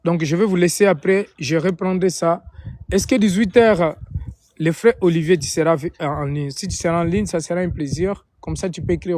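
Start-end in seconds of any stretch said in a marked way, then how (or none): none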